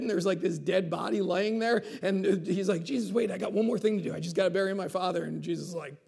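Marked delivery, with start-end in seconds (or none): 2.8-5.2 hurried excuse. Retold or imitated. Voicing the man making excuses.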